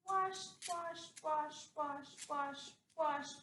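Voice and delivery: steady, evenly spaced repeats of "wash"; rhythmic scrubbing. Acted. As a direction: chant "wash" like the strokes of a sponge. Acts out scrubbing the dog.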